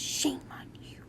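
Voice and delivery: soft unvoiced whisper of mock scolding. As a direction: hiss a tiny reproach to yourself. Scolding herself for going back.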